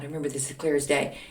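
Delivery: ordinary delivery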